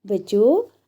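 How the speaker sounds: ordinary talk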